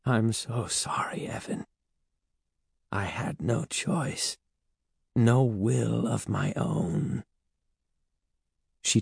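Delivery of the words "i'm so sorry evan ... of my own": soft, slow, remorseful apology; hushed, sorrowful confession; sighing regret. A narrator's reading. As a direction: apologise quietly, weighed down with helplessness. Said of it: "She sighed".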